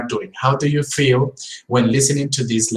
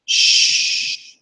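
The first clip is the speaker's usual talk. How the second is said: hushing shh. Shown as a demonstration. Teacher showing the sh sound. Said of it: "asking somebody to be silent".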